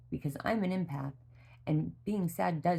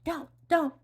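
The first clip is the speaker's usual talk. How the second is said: put-on much higher, small begging little girl voice; pleading child; 13 semitones higher, 4 dB louder than usual. Daughter refusing to hear about her sister, high pleading child voice. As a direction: beg it like a scared child covering her eyes. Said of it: "she covered her eyes and she said".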